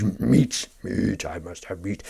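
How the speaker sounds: lightly voiced craving brute, drawn out; mock savage meat-craver. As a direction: play a meat-obsessed savage, dragging it out. Mocks a savage meat eater.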